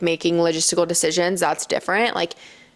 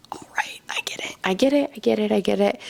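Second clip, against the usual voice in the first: whispered giving in; 3 semitones higher than usual. Retold or imitated. Giving in to repeated lessons.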